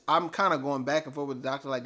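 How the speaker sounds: ordinary delivery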